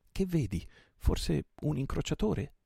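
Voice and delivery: high, slow, eager asking; eager question. A narrator's reading. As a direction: ask in a rush of curiosity. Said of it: "chiese il portoghese accorrendo".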